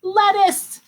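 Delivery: high, loud cheer of "Lettuce"; excited reveal. Shown as a demonstration. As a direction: sing out "Lettuce" proudly. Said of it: "you might make a salad with it".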